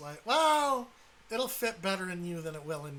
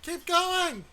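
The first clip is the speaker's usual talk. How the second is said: urgent shout; 9 semitones higher, 5 dB louder than usual. Real, not acted. Urging teammate on.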